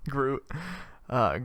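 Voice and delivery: ordinary talk, nothing marked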